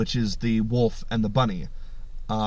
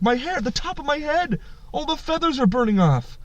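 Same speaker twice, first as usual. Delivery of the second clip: screaming in distress; 12 semitones higher than usual. Acted. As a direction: wail in pain as the burning eagle. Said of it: "And he's screaming".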